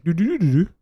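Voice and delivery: mocking nonsense babble. Co-host imitating what every person sounds like, mocking babble. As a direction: reduce the question to silly babble. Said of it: "That's what every person sounds like".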